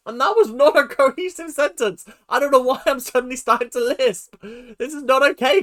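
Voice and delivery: high, loud, worked-up protest; flustered. Real, not acted. Remarking on suddenly starting to lisp, flustered exclaiming. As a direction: protest at your own muddled words. Worked up, mocking own slip.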